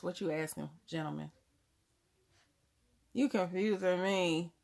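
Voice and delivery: ordinary talk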